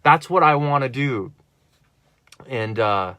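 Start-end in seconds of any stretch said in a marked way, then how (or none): none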